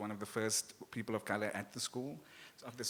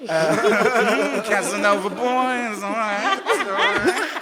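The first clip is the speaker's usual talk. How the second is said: mock the gang's name through laughter; laughing mockery; 10 semitones higher, 15 dB louder, much slower than usual. Real, not acted. Mocking the father's gangster friends' name, laughing. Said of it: "such a queer name anyway".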